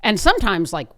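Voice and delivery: ordinary talk, nothing marked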